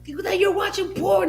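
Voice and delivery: very high, loud, slow mock-shriek of an outraged mother; imitating yelling mom. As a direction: shriek it like a mother who caught her son. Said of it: "She was like".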